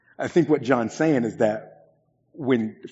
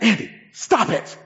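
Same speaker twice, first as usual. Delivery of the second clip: higher, slow mock complaint; mock annoyed; 11 semitones higher, 5 dB louder than usual. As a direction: groan at a friend playfully. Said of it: "And I'm like".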